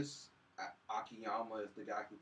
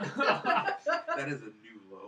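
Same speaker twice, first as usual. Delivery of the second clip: laughing; 3 semitones lower, 8 dB louder, much slower than usual. Real, not acted. Laughing at a bad rating.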